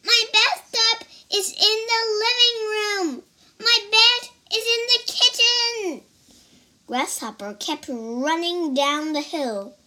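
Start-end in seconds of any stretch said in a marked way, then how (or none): 0.0-6.0 high, loud squeaky worm cry; child voicing a frantic little worm. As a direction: yell for help as a tiny panicked worm. Worm voice continues.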